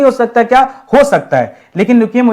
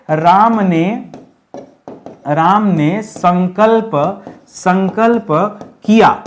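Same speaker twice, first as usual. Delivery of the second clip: slow, spaced dictation pace; much slower than usual. Shown as a demonstration. Teacher dictating the corrected sentence slowly. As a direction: dictate it word by word. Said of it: "तो क्या होना चाहिए".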